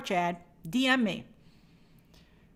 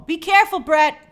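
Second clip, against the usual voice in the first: loud, high call of be careful; urgent warning; 5 semitones higher, 8 dB louder than usual. Real, not acted. Host warning a viewer about the hurricane, loud and urgent. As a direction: warn him sharply, voice up high.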